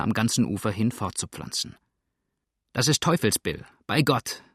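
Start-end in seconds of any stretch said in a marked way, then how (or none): 2.7-4.4 pirate calling out with mild jubilation; a touch of triumph, understated; jubilant call. A narrator's reading. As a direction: recognise a mate and call it out. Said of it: "rief der Pirat und schwenkte jubelnd den Hut".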